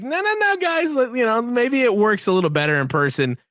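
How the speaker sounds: high-pitched protest easing down toward normal; imitating a hopeful defender cutting in; retold pleading. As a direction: plead high, then settle into reasoning. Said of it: "hang on and be like".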